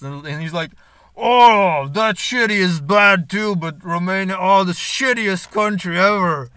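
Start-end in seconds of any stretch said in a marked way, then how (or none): 1.2-6.5 loud, high, drawn-out exclaiming; mock loud rant. Barman ranting about Romania to French girl, loudly exaggerated. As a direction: imitate an over-the-top complainer, booming.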